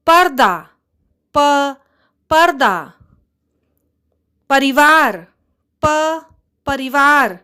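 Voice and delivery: loud, bright teacher drill, प split off. Shown as a demonstration. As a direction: call each word out for the class to repeat. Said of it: "चलिए उन शब्दों को दोहराते हैं".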